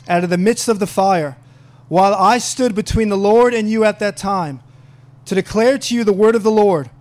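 loud, declarative Bible reading; emphatic preacher reading the Word aloud; scripture reading. Acted. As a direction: proclaim the passage phrase by phrase. Reads scripture aloud to congregation.

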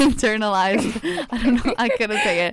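laughing through the words; real amused laughter. Real, not acted. Speaker laughing at failing to say a word, amused and giggly. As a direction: laugh at your own stumble, pitch lifting.

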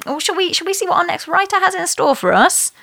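usual speaking voice

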